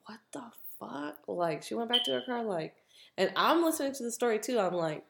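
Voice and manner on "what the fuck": hushed, breath-only disbelief; stunned whisper. Retold or imitated. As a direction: whisper it as if lost for words. Cousin's stunned reaction, retold.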